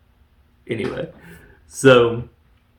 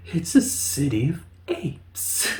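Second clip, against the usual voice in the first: mocking delivery; 2 semitones higher, 5 dB quieter than usual. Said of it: "The way he delivers these lines".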